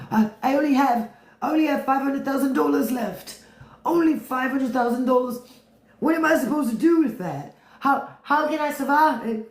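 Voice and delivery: high, whining mock rich man; whining Elon. Elon whining to the street about money. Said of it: "this is Elon".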